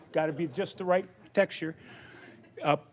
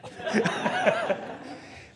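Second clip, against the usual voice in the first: a high, airy burst of laughter; real laugh. Real, not acted. Speaker laughing at his own joke to the audience.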